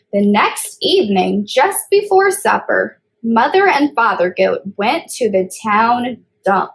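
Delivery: put-on reading a picture book aloud to kids; storybook reading. A narrator's reading. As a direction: read it like story time for little ones. Child reads storybook aloud.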